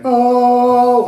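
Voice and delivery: sudden high oh of discovery; surprised oh. Real, not acted. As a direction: exclaim it as if spotting something. Interrupts herself, exclaims.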